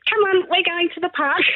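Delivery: high, bright fake-cheerful voice; forced cheer. Scared mum whisking her toddler to the park, forced cheer. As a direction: hide the fright behind cheer.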